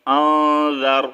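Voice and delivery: آن hummed through the nose before در; ghunna. Shown as a demonstration. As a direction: hold the hidden noon of آن, then say در. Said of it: "غنہ کریں گے". (آن پر غنہ کر کے در)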